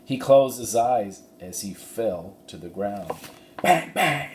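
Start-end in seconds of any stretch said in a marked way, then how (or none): 3.6-4.3 mock gunshots, higher; gunshot imitation. Narrator imitating the rustlers' gunfire, mock bangs. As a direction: pop out the shots.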